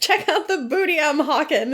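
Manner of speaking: high-pitched playful sales cry; hammed-up pitch to passers-by; mock yelling. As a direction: imitate a hawker calling out. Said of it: "yelling out".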